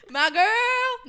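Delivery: very high, loud exaggerated call; mock holler. Friend mimicking boyfriend calling out, high loud holler. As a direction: yell it across the house, hammed-up.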